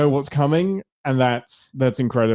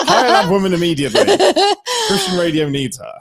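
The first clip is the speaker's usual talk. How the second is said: laughing; 14 semitones higher, 4 dB louder than usual. Real, not acted. Laughing praise of radio voice.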